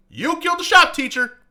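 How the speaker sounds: loud accusation. Retold or imitated. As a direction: shout the line out at him. Repeated accusation, louder take.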